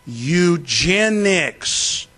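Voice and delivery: very slow, stretched-out mock explanation; mocking slow pronunciation; drawn-out word. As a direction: drag the word out for a slow listener. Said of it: "that's how they talk to you".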